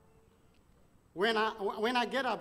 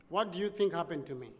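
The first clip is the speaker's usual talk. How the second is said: usual speaking voice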